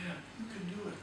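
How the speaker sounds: soft, gentle encouragement; true inner voice. Shown as a demonstration. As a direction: whisper it kindly to yourself. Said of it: "true part of you that's whispering".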